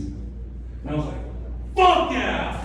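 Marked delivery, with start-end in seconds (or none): 1.8-2.6 high-pitched thrilled cheering; excited yell. Retold or imitated. Comedian reenacting his joy at the doctor's news, high and excited. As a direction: whoop with relief, pitched way up. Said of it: "please calm down".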